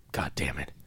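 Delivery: a soft unvoiced whisper; whispered curse. Real, not acted. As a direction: breathe it out, no voice at all. Reacting to movie gore.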